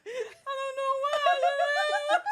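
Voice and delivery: high, laughing imitation of a crying patient; half-laugh, half-sob as the teary client; mock weepy patient. Retelling a patient who cried for hours, high mock sob. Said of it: "she was like".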